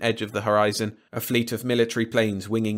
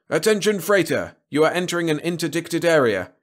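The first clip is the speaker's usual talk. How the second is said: high, raised radio hail; patrol boat officer; 8 semitones higher, 4 dB louder than usual. A narrator's reading. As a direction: official warning called out to a ship. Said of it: "a voice from the patrol boat".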